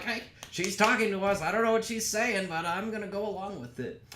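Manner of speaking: high, loud burst of eager glee, easing at the end; excited men. Acted. Men amazed a woman is talking to us, excited. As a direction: play clueless guys thrilled to get attention.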